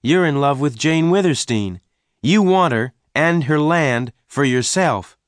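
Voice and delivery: put-on high, forceful young man's accusation; accusing. A narrator's reading. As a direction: throw the accusation at your rival. Venters accuses Tull.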